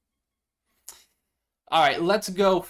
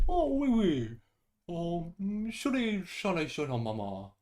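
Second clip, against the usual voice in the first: higher, badly faked French accent; 3 semitones higher, 4 dB quieter, slower than usual. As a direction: fake French accent trailing off softer. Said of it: "Not even French accent?".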